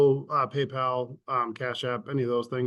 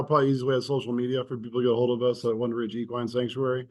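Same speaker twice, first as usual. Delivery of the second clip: rattling it off fast; rushed, hurried delivery; speeding up; 2 semitones lower, faster than usual. Real, not acted. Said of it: "You talk really fast".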